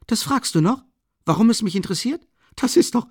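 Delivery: astonished he even asks; eager outburst. Acted. Friend amazed at Raskolnikov's question, eager and high.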